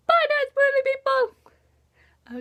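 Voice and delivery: high, loud playful farewell; sing-song goodbye. Acted. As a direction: wave them off in a chirpy high voice.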